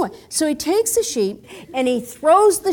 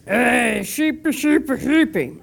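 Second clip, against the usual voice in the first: practiced shepherd call. Acted. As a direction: rehearse calling sheep in a borrowed voice. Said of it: "he goes and he practices".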